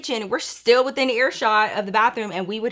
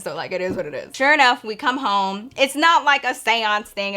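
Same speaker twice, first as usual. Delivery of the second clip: normal talking voice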